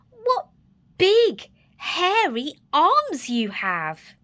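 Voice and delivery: slow, loud and wary; careful, slow. Acted. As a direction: draw it out with growing unease. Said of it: "Little Red said carefully".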